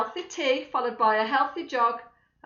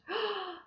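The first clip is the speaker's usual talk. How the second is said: high surprised gasp; sharp gasp; 11 semitones higher, 5 dB quieter than usual. Acted. Missus Large finding one piece left, gasping. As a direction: gasp at the empty cupboard.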